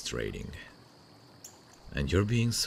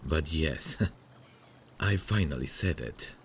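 ordinary delivery

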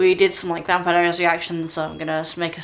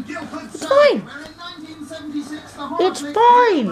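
high laughing reassurance; 10 semitones higher, much slower than usual. Real, not acted. Soothing dog, laughing.

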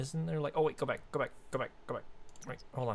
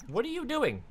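startled; 13 semitones higher than usual. Real, not acted. Shocked at Sims character's mess.

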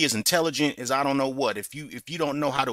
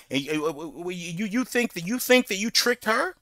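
ordinary talk

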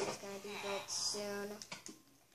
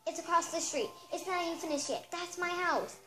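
raised, higher voice, insisting hard; angry rant; 6 semitones higher, 5 dB louder than usual. Acted. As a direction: jab every "my house" at the clerk. Angry guest ranting about her house.

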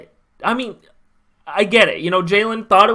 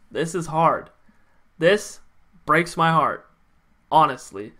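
softer and lower, subdued heartbreak; emotional; 6 semitones lower, 6 dB quieter than usual. Real, not acted. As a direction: let the disappointment sink the voice. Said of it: "I'm emotional now".

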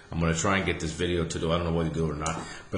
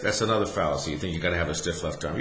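ordinary delivery

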